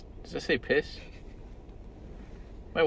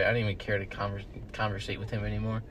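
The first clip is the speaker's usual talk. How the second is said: usual speaking voice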